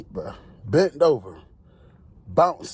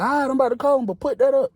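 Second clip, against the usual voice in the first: pitched way up for the officer; mock high woman's voice; 13 semitones higher, 4 dB louder, faster than usual. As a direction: play a casual female officer, high. Officer instructs.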